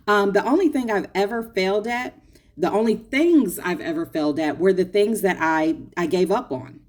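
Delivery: usual speaking voice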